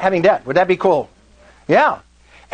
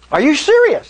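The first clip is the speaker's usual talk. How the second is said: high-pitched, incredulous question; retold disbelief; 9 semitones higher than usual. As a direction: replay your astonishment, pitched way up. Retold disbelief at her agreeing.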